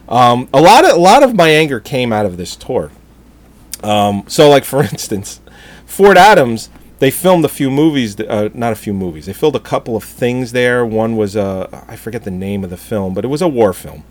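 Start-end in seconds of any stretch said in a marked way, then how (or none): none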